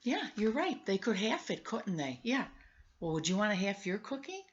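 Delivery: usual speaking voice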